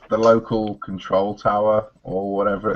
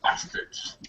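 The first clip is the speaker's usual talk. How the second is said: whispered curse; 10 dB quieter than usual. Acted. Muttering at the Imperial fleet.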